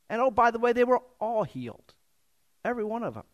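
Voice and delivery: high, casual aside; mock understatement. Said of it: "I'm sure he didn't say it that way".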